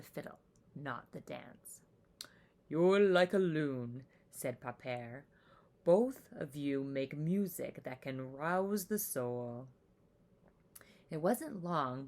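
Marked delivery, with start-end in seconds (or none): 2.7-9.7 lower, slow, gentle grandfather voice; Pepere's voice. A narrator's reading. Pepere telling Matisse her music stirs the soul, slow and gentle.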